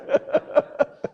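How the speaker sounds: a short airy burst of laughter; real amused laugh; breathy laugh. Real, not acted. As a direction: laugh out at the memory. Laughs at his own story.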